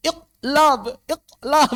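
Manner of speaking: "Iqlab" with q stopped dead, then "lab"; strained, high, loud "Iqlab" without bounce; without qalqalah. Shown as a demonstration. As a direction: force out "Iqlab", gap after the blocked q. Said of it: "Ngomong iqlab tanpa qalqalah".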